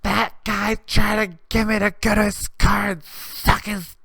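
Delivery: high, loud mock drunk girl yelling. Drunk woman outside the bar yelling at a guy, high mock yell. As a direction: shout it shrill-high like a drunk accuser. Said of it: "she starts yelling".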